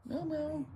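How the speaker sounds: high, soft meows; small high-pitched mewing; imitated cat meow. Real, not acted. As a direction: mimic a cat to call it over.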